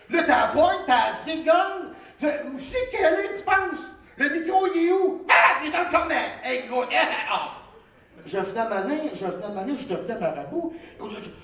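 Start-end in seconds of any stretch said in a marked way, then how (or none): none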